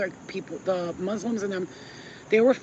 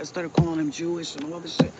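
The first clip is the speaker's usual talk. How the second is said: usual speaking voice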